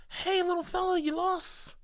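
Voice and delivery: put-on high, soft sing-to-a-toddler voice; cooing to a lost child. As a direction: coax a little lost kid, high and gentle. Imagined adults cooing to lost child.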